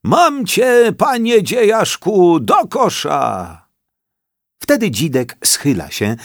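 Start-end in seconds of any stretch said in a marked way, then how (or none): 0.0-3.7 put-on high triumphant old man's call; grandpa's cheerful high call. A narrator's reading. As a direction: crow it out as grandpa spots the mushroom. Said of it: "pokazuje go laską i woła".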